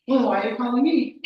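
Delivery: loud, high exasperated question; exasperated challenge. Acted. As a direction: demand an explanation from the caller. Acted challenge to the caller.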